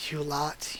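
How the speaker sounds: breathy whispered address. Acted. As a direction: say it under your breath, unvoiced. Character addresses the group.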